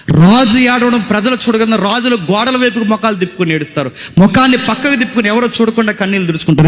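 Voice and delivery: high-pitched, driving explanation; fervent preaching. Real, not acted. Preacher explaining how kings hide their tears, fervent preaching. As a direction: preach it high, pressing on without slack.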